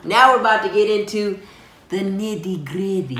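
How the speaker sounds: lightly attempted Al Capone-style Italian accent; a playful, wobbly stab at an Italian gangster accent; shaky Italian accent. Husband announcing the next part to viewers, in a shaky Italian accent. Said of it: "I'm not sure what accent that is".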